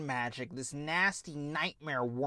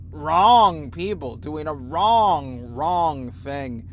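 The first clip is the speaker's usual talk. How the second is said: each "wrong" hit hard and slow; stress on wrong; 8 dB louder, slower than usual. Real, not acted. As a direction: hammer every "wrong".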